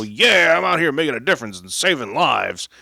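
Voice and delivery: loud, high-pitched mock enthusiasm; gung-ho superhero. Mocking the paramedic out saving lives, loud gung-ho superhero voice. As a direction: ham up an over-eager hero, shouting it out. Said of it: "Mister Superhero gung-ho".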